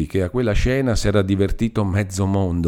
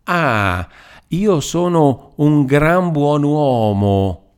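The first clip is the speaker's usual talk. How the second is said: slow, higher, sneering drawl on io; mocking irony; 7 semitones higher, 4 dB louder, much slower than usual. A narrator's reading. Old Chiesa mocking Demetrio's insult: slow sneering irony. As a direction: stretch it out, mocking every word. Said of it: "tono sibilante e canzonatorio".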